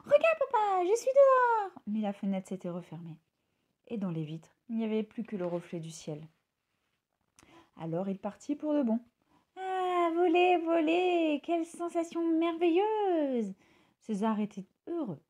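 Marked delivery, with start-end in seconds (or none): 0.0-1.7 very high, loud triumphant call; gleeful shout. A narrator's reading. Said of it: "pour crier à son papa". 9.6-13.5 high, slow, savouring words; dreamy thrilled bird; delighted. A narrator's reading. Bird's first free flight.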